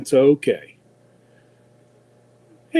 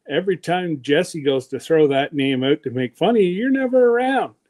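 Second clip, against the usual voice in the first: ordinary talk, nothing marked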